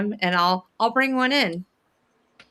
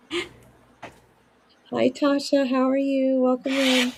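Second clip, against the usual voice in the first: put-on baby talk; 4 semitones higher, much slower than usual. Greeting the baby chick.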